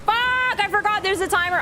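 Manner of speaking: high, loud burst of alarm; panicked yell. Real, not acted. Player remembers the timer, panicked high yell. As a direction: blurt it out high in sudden panic.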